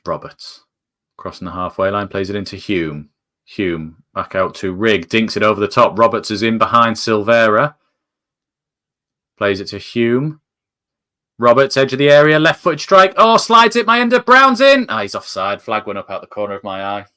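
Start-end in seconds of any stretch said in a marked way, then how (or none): 0.1-10.4 steady, slightly louder match commentary; live play-by-play. Acted. Fan calling the Sunderland build-up live, steady commentary. 11.4-14.9 shouting higher and louder as the chance opens up; excited commentary. Real, not acted. Fan calling a Sunderland chance, a rising excited shout. Chance builds to a near goal.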